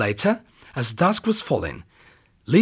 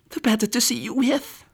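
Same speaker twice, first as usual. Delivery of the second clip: much higher sweet fake granny voice; wolf as Grandma; 11 semitones higher than usual. Wolf answers the girl in his fake granny voice. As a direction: keep up the granny act.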